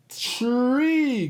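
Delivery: tree said with a firm T then R; clear T R. Shown as a demonstration. Host shows kids the right way. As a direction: stretch out tree so the T R blend is clear. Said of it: "you say".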